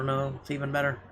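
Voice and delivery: ordinary delivery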